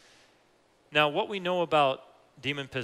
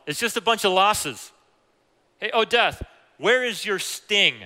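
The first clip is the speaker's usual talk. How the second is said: high, loud mocking taunt at death; 7 semitones higher, 8 dB louder than usual. Preacher mocking death to the congregation, loud taunting challenge. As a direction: jeer at death like a beaten rival.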